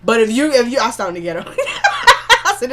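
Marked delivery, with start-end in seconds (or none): 1.6-2.4 high, loud laugh; burst of laughter. Real, not acted. Podcaster tickled by her own stumble, high burst of laughter.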